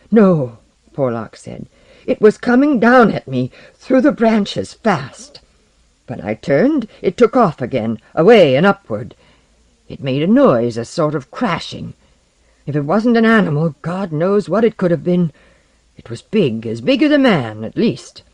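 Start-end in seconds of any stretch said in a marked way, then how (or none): 0.1-0.6 loud, emphatic refusal; alarmed denial. Acted. Porlock rejects the falling vine idea, emphatically. Frightened man rejects the explanation. 2.1-18.2 loud, urgent, broken phrases; alarmed insistence. Acted. Porlock insists something attacked through branches, alarmed. Frightened man insists on his sighting.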